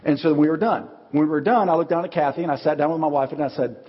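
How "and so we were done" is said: ordinary talk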